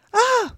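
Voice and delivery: very high-pitched "ah!"; exclaimed "ah". A narrator's reading. Mock Turtle reacting to Alice's answer, high exclamation. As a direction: let out a sudden high "ah".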